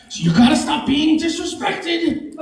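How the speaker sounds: high, slow mock indignation; defensive comic. Comic imitating himself after bombing, high mock indignation. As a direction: insist on respect, drawn out. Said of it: "And I go".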